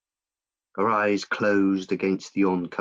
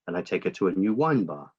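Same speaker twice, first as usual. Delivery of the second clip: ordinary delivery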